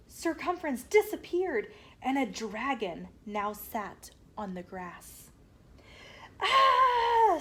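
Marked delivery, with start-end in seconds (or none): 6.4-7.4 high, loud startled scream. A narrator's reading. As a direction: shriek in shock at the dragon. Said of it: "screamed Radius".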